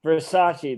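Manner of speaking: "Versace" said as ver-SAH-chee; correct pronunciation. Acted. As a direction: teach "Versace" with a firm SAH, then chee. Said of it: "That's how it's pronounced".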